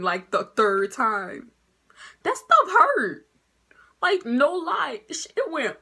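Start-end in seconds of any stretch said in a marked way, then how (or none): 2.2-5.0 high, loud and slow; emphatic complaint. Real, not acted. Teen recalling her mama's slap, high and loud. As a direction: stretch it out like it still stings.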